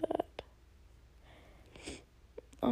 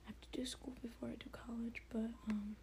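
whispering; 14 dB quieter than usual. Real, not acted. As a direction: back to a whisper. Whispering again.

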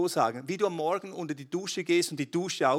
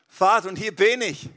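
loud, high, impassioned praying; fervent prayer; 6 semitones higher, 11 dB louder than usual. Acted. Preacher leads the church in praise, loud and fervent. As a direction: pour it out to God.

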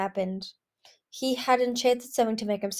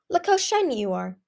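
raised voice full of wonder; 5 semitones higher, 5 dB louder than usual. A narrator's reading. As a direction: marvel at it, pitched higher. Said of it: "she said with wonder".

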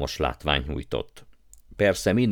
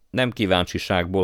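plain reading voice

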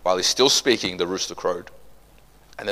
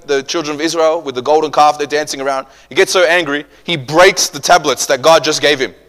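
ordinary talk

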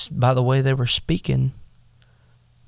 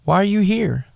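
higher, scornful big-brother challenge; mocking brothers; 7 semitones higher than usual. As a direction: sneer down at the little brother. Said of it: "is like".

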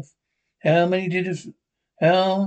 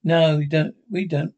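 ordinary talk, nothing marked

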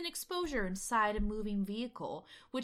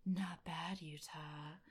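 quiet breathy imitation, trailing off low; soft, airy mock-flirty line; 3 semitones lower, 9 dB quieter, much slower than usual. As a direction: let the words fade out on breath. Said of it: "like, very breathy".

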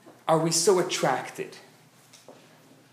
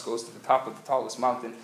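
usual speaking voice

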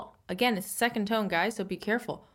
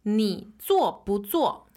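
slow model reading; slower than usual. Shown as a demonstration. Teacher models the sentence slowly.

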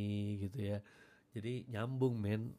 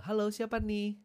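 high cheery radio host picking up a call; bright on-air phone greeting; 11 semitones higher, 6 dB louder than usual. Retold or imitated. Radio host answering a listener's call, cheery and high. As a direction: greet the caller upbeat.

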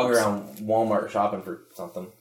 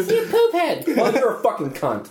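mock childish insults, laughing; 13 semitones higher than usual.

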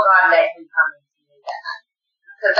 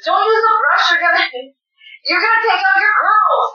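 brother yelling; 12 semitones higher than usual. Retold or imitated. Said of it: "he yelled at me".

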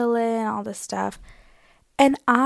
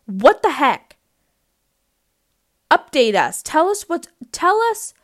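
mock exasperation; 7 semitones higher, 7 dB louder than usual. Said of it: "we were like".

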